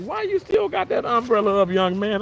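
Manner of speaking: playful scolding from above; teasing God voice. Retold or imitated. Retelling how God teased him about the umbrella, playful voice. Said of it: "God was like".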